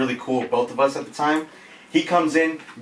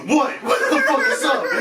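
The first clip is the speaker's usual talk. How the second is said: much higher, laughing yell; laughing mock yell; mock challenge; 12 semitones higher than usual. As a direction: call across the room daring him. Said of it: "Mo goes".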